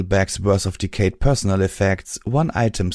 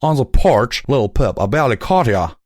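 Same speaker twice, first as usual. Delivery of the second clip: louder scolding southern stallion; 4 semitones higher, 5 dB louder than usual. A narrator's reading. Calamity scolding.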